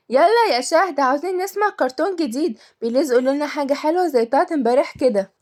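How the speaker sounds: put-on eager child's loud call; calling out loudly. A narrator's reading. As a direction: call upstairs for a story, pleading. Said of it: "وكملت بصوت عالي".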